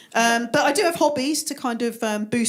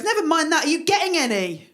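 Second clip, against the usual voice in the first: nosy doctor cutting in, higher voice; raised, prying doctor voice; 6 semitones higher than usual. Acted. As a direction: butt in eagerly as a mock doctor. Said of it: "and the doctor goes".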